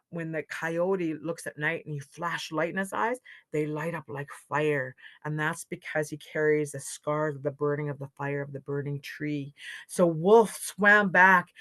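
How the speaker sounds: ordinary delivery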